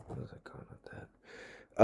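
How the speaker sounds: trailing whisper to himself; whisper to self. Real, not acted. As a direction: lose the thread and whisper it aside. Muttering to himself, lost thread.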